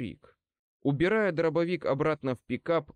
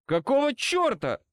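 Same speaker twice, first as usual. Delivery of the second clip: high, exasperated exclamation; резкий выдох-возглас; 11 semitones higher, 4 dB louder than usual. A narrator's reading. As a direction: blurt it out, high and startled. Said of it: "выдохнул я". (возмущённо, на выдохе)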